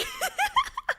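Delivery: shrill giggle squeal; high squealing laugh. Real, not acted. Streamer flustered by a flirting chatter, squealing laugh.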